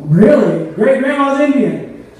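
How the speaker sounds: high, loud disbelieving question; hammed-up delighted surprise; acted amazement. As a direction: marvel at astonishing family news. Acted amazement at grandma's news.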